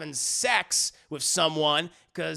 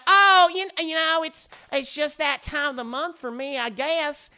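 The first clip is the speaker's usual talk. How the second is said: put-on high, loud Southern-accented excuse; hammed-up Southern wife, flustered and stalling; Bridget; 8 semitones higher, 6 dB louder than usual. Wife makes excuses for being flushed, high Southern accent. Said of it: "She has a very deep Southern accent".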